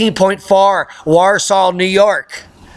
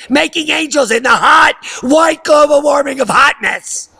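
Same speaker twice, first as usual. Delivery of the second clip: high, loud hammed-up shouting rant; mock global warming alarmist; 8 semitones higher, 4 dB louder than usual. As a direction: scream it like a frantic climate preacher.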